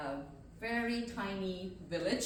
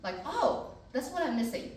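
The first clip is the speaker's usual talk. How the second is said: surprised; 8 semitones higher than usual. Retold or imitated. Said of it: "I'm like".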